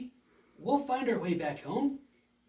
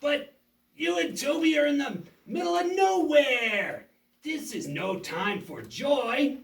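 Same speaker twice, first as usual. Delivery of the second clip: taunting villain; 4 semitones higher, 4 dB louder than usual. Acted.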